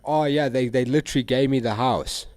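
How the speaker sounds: loud smug bragging; mock bragger. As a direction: ham up a smug show-off. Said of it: "It's like".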